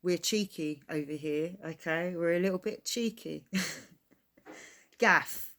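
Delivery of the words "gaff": laughing. Real, not acted. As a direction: say it through a laugh. Laughs reading the next word.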